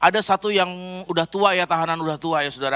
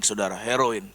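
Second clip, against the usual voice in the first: normal talking voice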